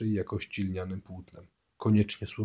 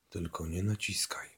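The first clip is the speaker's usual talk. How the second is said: hushed whisper to himself; unvoiced inner whisper; whispered thought; 7 dB quieter than usual. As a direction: breathe it as a private warning. His inner warning thought.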